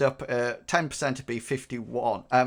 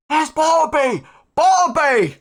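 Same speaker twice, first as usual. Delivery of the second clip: high, loud cry of recognition; crowd shout; 9 dB louder than usual. Acted. Somebody in the rabble spots Bartleby, excited shout. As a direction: call out high over a crowd. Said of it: "shout comes up".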